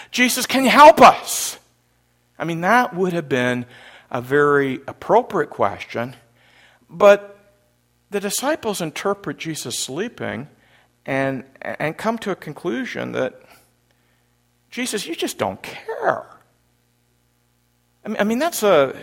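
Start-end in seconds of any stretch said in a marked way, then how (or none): 0.1-1.6 loud, high appeal for help; acted raised-voice pleading; urgent plea. Imagined disciples pleading for help. 14.7-16.3 high, hurt-sounding reproach; acted wounded complaint; sad accusation. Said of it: "a terrible accusation".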